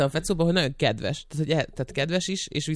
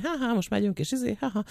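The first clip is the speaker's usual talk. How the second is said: higher-pitched put-on cheeriness; mock upbeat show mood; 7 semitones higher, 4 dB quieter than usual. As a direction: imitate the show's bubbly ha-ha energy lightly. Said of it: "ilyen folyamatosan feldobott hangulatot közvetít".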